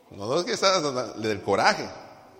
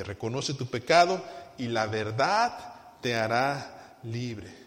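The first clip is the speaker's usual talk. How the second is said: ordinary talk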